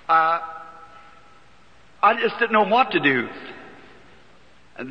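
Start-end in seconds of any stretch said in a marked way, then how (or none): none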